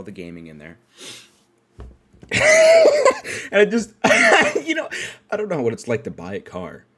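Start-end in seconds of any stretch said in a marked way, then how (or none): 2.2-3.4 loud, high-pitched laugh; real laugh. Real, not acted. Storyteller amused by his car search, loud laugh. 4.0-4.6 short, high, loud laugh; real laugh. Real, not acted. Storyteller cracking up at himself, loud laugh.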